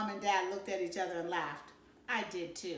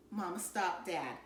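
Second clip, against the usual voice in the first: usual speaking voice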